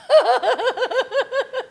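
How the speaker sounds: high burst of laughter. Real, not acted. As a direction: crack up laughing, high and free. After joke about harassing crew.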